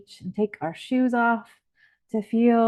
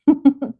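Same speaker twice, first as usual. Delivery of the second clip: short, high, loud giggle; tickled giggle; 6 semitones higher, 9 dB louder than usual. Acted. Guide feels foam tickle on the feet, light giggle. Tickled by bubbles in imagined scene.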